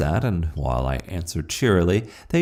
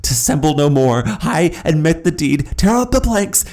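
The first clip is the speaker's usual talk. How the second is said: loud, much higher frantic screaming; shrieked confession; 9 semitones higher, 7 dB louder than usual. A narrator's reading. Killer confessing to the officers, shrieking. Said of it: "I shrieked".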